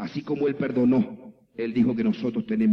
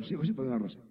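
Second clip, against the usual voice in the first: imitated grudging mumble, much softer; reluctant mumbled prayer; 8 dB quieter, much faster than usual. Woman repeats pastor's prayer unwillingly, reluctant mumble.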